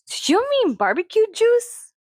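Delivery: high, swooping question; puzzled store clerk. Retold or imitated. Clerk gently checking what the customer meant, puzzled imitation in a high lilt. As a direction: imitate a sweetly naive clerk, voice leaping up. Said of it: "and so she goes".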